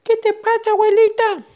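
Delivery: very high, squeaky ant; tiny little voice. Acted. Unseen ant asking grandma what's wrong, tiny squeaky voice. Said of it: "sentía una vocecita".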